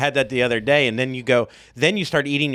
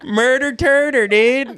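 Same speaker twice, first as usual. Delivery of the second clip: surfer dude; 12 semitones higher, 5 dB louder, slower than usual. Retold or imitated.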